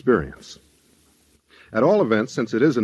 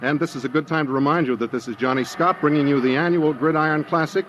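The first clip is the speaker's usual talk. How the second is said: brisk, raised stadium announcer voice, pitched higher; live football play-by-play; 7 semitones higher than usual. Acted. Johnny Scott announcing the Gridiron Classic to his listeners, lively play-by-play. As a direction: call the game like a radio sportscaster on air.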